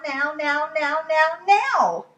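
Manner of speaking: put-on childish demand building to a squeal; building whine. Lola demanding her book from Charlie, building to a squeal.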